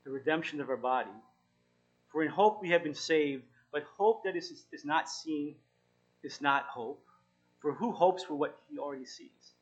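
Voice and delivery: lightly heightened Bible reading; scripture reading. Acted. Pastor reading Romans aloud to the group, a touch solemn. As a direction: read the verse out with weight.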